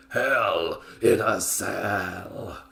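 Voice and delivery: drawn-out, slow and weighty; slow dramatic delivery. Acted. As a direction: stretch every word out. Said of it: "He didn't say it like that, he said it in Scottish".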